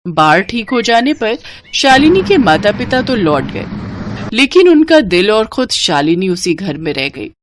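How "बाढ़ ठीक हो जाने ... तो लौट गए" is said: usual speaking voice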